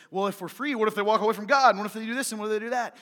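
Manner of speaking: loud, rising fretful objection; mock panic. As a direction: imitate worriers firing off what-ifs. Voicing fearful objectors to freedom.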